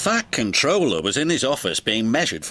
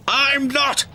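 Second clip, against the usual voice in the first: higher, grumpy protest; cranky denial; 11 semitones higher than usual. Acted. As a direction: grumble it back sharply. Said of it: "Cranky cranked".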